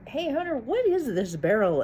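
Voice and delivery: high, light mock Johnny voice; a hint of Johnny, pitched high; imitating Johnny. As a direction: play Johnny asking, curious and high.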